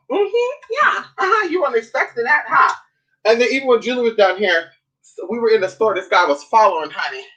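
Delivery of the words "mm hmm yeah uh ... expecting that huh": gleeful squeal. Real, not acted. Gleeful squeal after her comeback.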